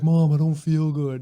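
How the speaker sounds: whiny fake-sick kid voice; fake sick whine. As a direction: play sick to stay home from school. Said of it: "I'd be like".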